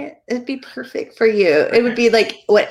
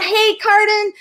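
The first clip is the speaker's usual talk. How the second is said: cheerful greeting; 10 semitones higher, 4 dB louder, slower than usual. Real, not acted. Greeting viewers by name.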